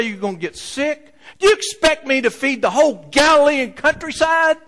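high, loud exasperated scolding; scolding mother. Acted. Mother scolding Irving for giving lunch away, loud exasperated. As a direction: scold the boy like a fed-up mom.

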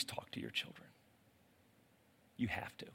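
hushed, urgent insistence; breathy earnest plea; urgent whisper. Real, not acted. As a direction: lean in and whisper it to the parents. Urging parents, drops to whisper.

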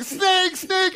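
high, sudden cry of alarm; panicked yell. Retold or imitated. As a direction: yell the warning at a snake underfoot. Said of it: "and I'm yelling".

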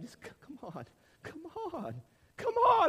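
building from coaxing to overjoyed calling; building joyful call. Retold or imitated. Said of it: "and he's like".

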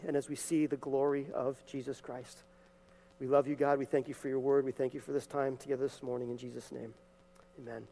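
soft, hushed pastoral prayer. Real, not acted. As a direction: pray quietly over the congregation before the sermon. Leads the congregation in prayer.

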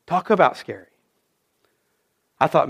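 normal talking voice

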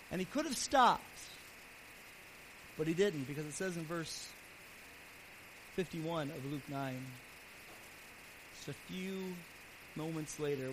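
ordinary talk

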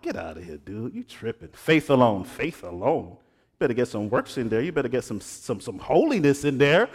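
mocking his younger self. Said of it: "I'm like".

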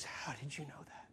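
barely audible whispered question; stunned whisper. Retold or imitated. Weeping lady asking traveler, stunned whisper. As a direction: ask it in a low hush, as if exposed.

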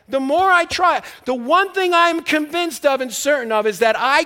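the preacher shouts over the congregation; preaching at full force. Acted.

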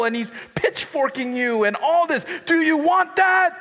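loud, high, shouting fire-and-brimstone preacher, laughing at first; hellfire youth preacher. Retold or imitated. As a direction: ham up a yelling hellfire sermon. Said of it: "And he was like".

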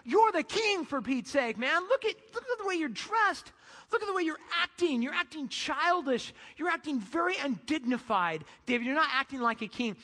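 hammed-up nagging at the husband; wife scolding David in the street, high and sharp. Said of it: "She's like".

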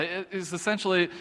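ordinary talk, nothing marked

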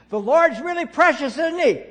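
very loud and high, straining to reach a deaf lady; near-shouted cheerful question; shouting. Retold or imitated. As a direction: bellow it at a hard-of-hearing old woman. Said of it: "and I don't shout, look, look around".

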